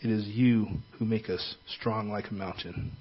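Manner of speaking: soft, earnest prayer voice. Acted. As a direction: pray quietly and earnestly. Leads a prayer.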